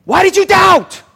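loud, high scolding shout. Acted. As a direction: berate him at full voice. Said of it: "Jesus is scolding him".

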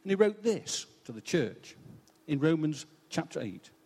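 normal talking voice

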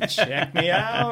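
slow, showy brag; mock show-off. Eighties show-off boasting about his flashy bike. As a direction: strut it like a peacock. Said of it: "It's like".

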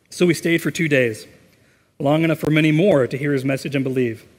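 lively, emphatic narration with clear phrase breaks; scripture story reading. A narrator's reading. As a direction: read the Bible story out to a congregation. Reads a scripture story aloud.

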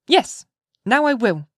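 put-on high, slow little girl's voice; eager child. A narrator's reading. Child agreeing to come to her mother, high childlike voice. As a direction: answer as a small child giving in.

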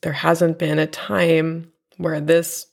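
usual speaking voice

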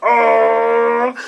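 very high, loud crowd aww; crowd's delighted oh. Retold or imitated. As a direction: exclaim like a charmed audience. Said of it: "the whole audience was like".

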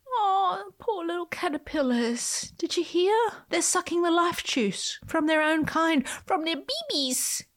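high-pitched cooing dismay; hammed-up pitying wail; mock pity. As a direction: lament in a high, over-sorry voice for tiny victims. Mock horror over eaten caterpillars.

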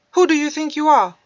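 loud, high blurt; loud high-pitched angry blurt. Acted. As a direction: burst it out all at once. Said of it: "he finally blurted out".

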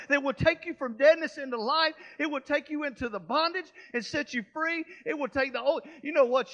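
loud, rising preacher's cry, punching each line; a surge of loud gospel preaching; preaching at full force. Acted. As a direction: preach it out to the back row, building the fire. Preacher builds to a peak.

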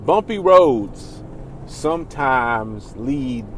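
slow, solemn mock-wise delivery; quoting a man. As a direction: drawl the saying like a sage. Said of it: "A man said".

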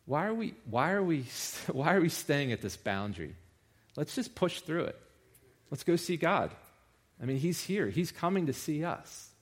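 lightly acted plea to push ahead; impatient crowd member. Restless worshipper urging others past the boundary, impatient and eager.

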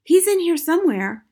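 high, loud urgent call; girl's high, urgent voice; insistent cry. A narrator's reading. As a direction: cry out to the searchers. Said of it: "Matilda cried".

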